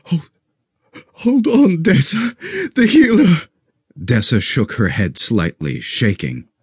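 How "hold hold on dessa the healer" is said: choked up. Acted. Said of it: "Ash choked".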